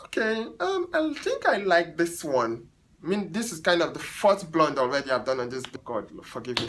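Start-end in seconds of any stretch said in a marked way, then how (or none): 0.1-2.7 much higher, slow mock hesitation; picky client. Stylist imitating the indecisive client, high and slow. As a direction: drag it out like a fussy client finally deciding. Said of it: "then she's like".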